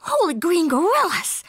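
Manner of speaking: high, startled cartoon cry; gulped exclamation. A narrator's reading. Skipito spotting a Martian, gulped exclamation. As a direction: exclaim it high, taken aback. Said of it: "gulped Skipito".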